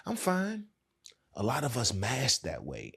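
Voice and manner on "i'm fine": high, light "everything's fine" voice, pitched well up; fake fine reply. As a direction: brush off the parent with a sing-song "I'm fine". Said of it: "You learn how to go".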